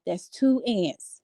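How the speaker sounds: slow, drawn out, each word weighted; slow emphasis. Shown as a demonstration. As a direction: spell it out slowly for effect.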